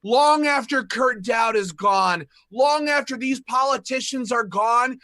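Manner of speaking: loud, high rallying shout. Real, not acted. Rallying gun owners against politicians, loud and high. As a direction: proclaim it like a rally cry. Rallying speech at full force.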